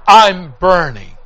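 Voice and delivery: loud, drawn-out retold cry; anguished cry. Preacher echoing dying neighbor's last words, loud anguished cry. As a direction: cry it out slowly and loudly.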